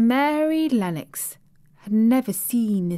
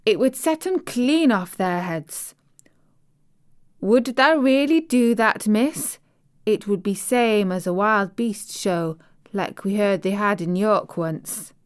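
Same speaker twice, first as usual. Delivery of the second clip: lightly voiced Yorkshire housemaid, chatty and pleased; broad Yorkshire vowels, friendly and eager; Yorkshire maid; 2 semitones higher than usual. Maid telling the little girl about home, light Yorkshire accent. Martha's Yorkshire dialect speech.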